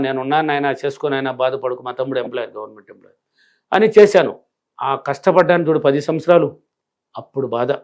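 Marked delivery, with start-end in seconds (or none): 0.1-3.2 mother's gentle reassurance. Retold or imitated. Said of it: "ఇంకా మా అమ్మ".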